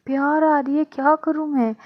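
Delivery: put-on a drunk girl gushing her love; tipsy Adhya pleading with Pranali, high and whiny; tipsy whine.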